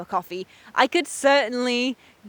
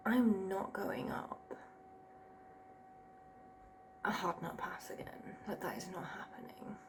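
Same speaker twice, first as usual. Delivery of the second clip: whisper; 5 semitones lower, 24 dB quieter than usual. Real, not acted. Hushed stressed talk.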